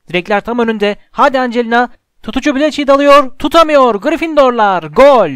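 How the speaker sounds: play-by-play building to a high shout; excited goal call. A narrator's reading. Commentator as Angelina scores for Gryffindor, building excited shout.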